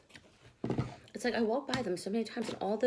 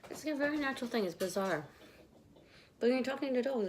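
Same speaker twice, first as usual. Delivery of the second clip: ordinary talk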